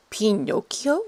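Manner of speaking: high, frail, wavering question; frail trembling old voice. Acted. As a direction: quaver it like a frail old woman. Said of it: "a frail voice from the back, trembling".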